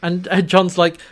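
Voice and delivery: ordinary talk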